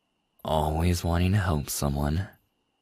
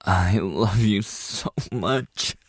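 slow, louder, tearful declaration; crying confession; 3 semitones higher, 3 dB louder, slower than usual. Acted. Confessing to a boss she loves, tearful and slow. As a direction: let the words break through tears, slowly. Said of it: "You don't like seeing me cry".

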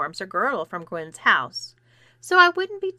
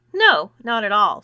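louder, lightly acted sneer; 7 dB louder than usual. A narrator's reading. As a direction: refuse with a mild, emphatic sneer. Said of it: "sneered Clarissa".

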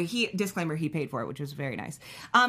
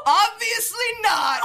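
high, slow, loud sarcastic reply; deadpan joke; 13 semitones higher, 6 dB louder, much slower than usual. Retold or imitated. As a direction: blurt it out slowly and high. Joking answer to "are you okay.

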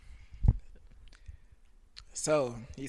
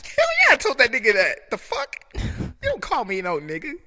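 loud, high, heated retelling; half-acted shout at the guy; reliving the fury out loud; mock angry yell; 9 semitones higher, 10 dB louder than usual. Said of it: "That's how mad I was".

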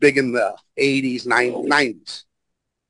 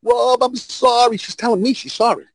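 higher-pitched mock pleading; imitated crying apology; 8 semitones higher than usual. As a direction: imitate a tearful, whiny apology. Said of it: "She's crying".

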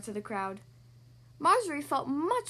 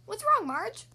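higher, louder chicken asking after a friend; clucking chicken; 8 semitones higher, 5 dB louder, slower than usual. A narrator's reading. Chickens ask Marjorie what is wrong, clucking chicken voice. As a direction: ask like a concerned hen, pitched up. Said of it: "clucked the chickens".

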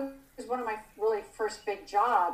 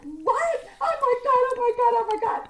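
much higher, breathless oh my Gods; excited yelling; 13 semitones higher, 6 dB louder than usual. Retold or imitated. The roommates on the phone, much higher and squealing with excitement. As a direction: gush like a shrieking fan. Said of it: "we're, like, yelling".

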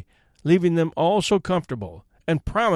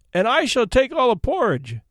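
eager, higher-pitched outburst; bursting in; 7 semitones higher than usual. A narrator's reading. Child offering to carry all the porridge, bursting in. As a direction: jump in with a raised, eager voice. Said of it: "I burst in".

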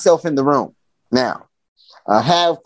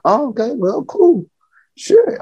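climbing higher and higher, nervous; 11 semitones higher than usual. Retold or imitated. Son agreeing nervously with dad in a rising squeak. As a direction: go up a step with each word.

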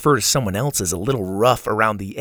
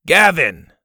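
loud, higher shout up to someone; calling up; 6 semitones higher, 7 dB louder than usual. A narrator's reading. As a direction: call sharply to a kid high overhead. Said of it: "Kirby called up to a kid".

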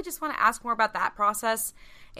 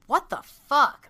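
exasperated; 6 semitones higher, 8 dB louder, slower than usual. Real, not acted. Venting about Twilight books.

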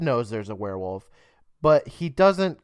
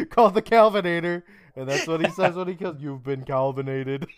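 higher pitch, laughing through the words; 5 semitones higher than usual. Real, not acted. Host explains his off-brand Terminator joke, cracking up laughing. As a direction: struggle to talk through real laughter.

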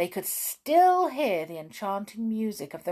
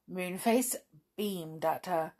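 ordinary delivery

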